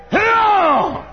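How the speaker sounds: high, loud sharp bark; sharp shout. Shown as a demonstration. Preacher shouting at an unruly toddler, sharp bark. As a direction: snap the word out to freeze the child. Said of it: "Say it".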